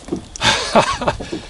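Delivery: high squeaky laugh. Real, not acted. Showing the second fish.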